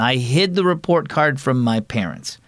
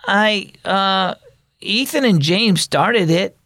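plain reading voice

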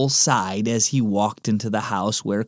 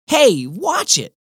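put-on annoyed kid; 10 semitones higher, 3 dB louder, slower than usual. Cole snaps at twin.

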